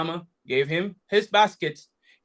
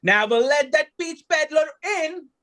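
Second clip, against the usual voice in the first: warning; 7 semitones higher than usual. A narrator's reading. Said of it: "she warned the castle guard".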